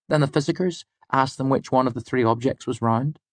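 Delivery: high-pitched student storyteller; Fela. Acted. Student telling the lecturer a medical discovery, high-pitched. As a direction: explain the fact in a high, even voice. Student tells her fact.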